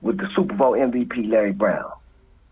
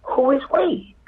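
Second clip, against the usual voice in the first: mocking rival; 12 semitones higher, slower than usual. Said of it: "And they say".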